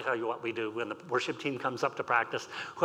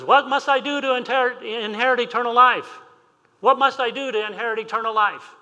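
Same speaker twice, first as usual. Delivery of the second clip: much higher and louder, urgent cry; desperate plea; 10 semitones higher, 9 dB louder than usual. Acted. Young man on his knees crying out to Jesus, urgent and loud. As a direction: beg it from your knees.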